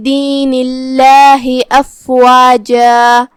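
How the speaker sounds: slow, measured tajweed chant; sustained, level-toned recitation; Quran recitation. Acted. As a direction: recite slowly with long drawn-out vowels.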